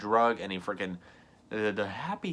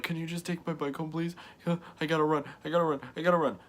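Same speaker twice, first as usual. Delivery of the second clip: higher-pitched retold plea; tearful pleading; 6 semitones higher, much faster than usual. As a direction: plead urgently, imitating an upset kid. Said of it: "like, crying".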